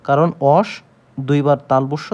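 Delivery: ordinary talk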